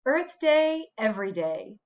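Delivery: ordinary delivery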